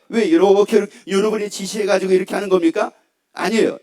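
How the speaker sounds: ordinary talk, nothing marked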